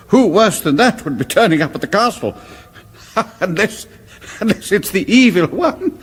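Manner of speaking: forced laugh at an absurd idea; nervous laughter. Acted. Laughs off the dreadful guess.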